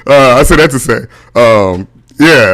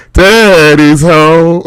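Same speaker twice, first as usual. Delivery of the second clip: high, loud, long-drawn Siri announcement; high drawn-out welcome, mock Siri; Siri greeting; 7 semitones higher, 5 dB louder, much slower than usual. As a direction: stretch it out high in a playful Siri impression. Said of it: "Siri's like".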